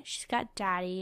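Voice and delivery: normal talking voice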